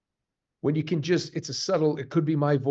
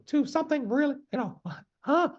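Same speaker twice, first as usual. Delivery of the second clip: much higher squeaky mouse voice with a laugh. Shown as a demonstration. Showing his range to the host, high mouse voice. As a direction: jump way up into a tiny mouse. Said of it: "I'm almost that mouse".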